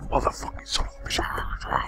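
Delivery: hushed, breathy swearing whisper; whispered curse. Acted. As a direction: whisper the curse through laughter. Cursing the dropped friend in whisper.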